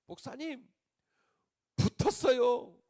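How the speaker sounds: a deacon telling his pastor he passed, shouting for joy; overjoyed news. Retold or imitated. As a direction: burst out with the good news. Deacon announces exam success.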